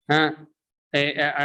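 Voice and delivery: ordinary talk, nothing marked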